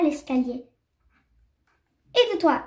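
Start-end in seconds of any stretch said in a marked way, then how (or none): 2.1-2.6 higher-pitched, restrained storybook shout; lightly acted cry, pitch lifted; mild triumphant cry. A narrator's reading. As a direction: call it out lightly as the fox. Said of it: "cria le renard".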